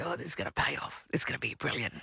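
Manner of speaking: put-on soft Italian-accented boast; quiet Italian accent, pleased with himself. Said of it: "but with an Italian accent".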